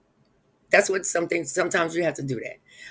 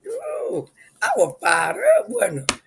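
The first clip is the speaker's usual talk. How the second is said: excited squeal; 4 dB louder, slower than usual. Real, not acted. Laughing recap of her angry outburst.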